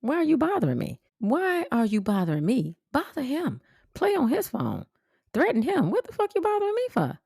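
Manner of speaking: high, whiny mocking voice of the caller; imitating the girl. Girl calling to harass her, mocked in a high whine. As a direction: mimic her rising into a shrill rant. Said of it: "she'll call me and say".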